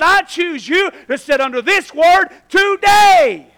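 loud, high, driving sermon voice; full-force preaching. Real, not acted. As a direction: preach it out to the congregation.

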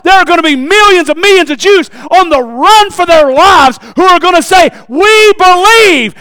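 loud, high, shouted sermon; full-force preaching. Real, not acted. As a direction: build to a roaring pulpit proclamation.

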